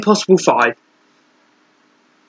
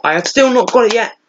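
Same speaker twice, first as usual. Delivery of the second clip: normal talking voice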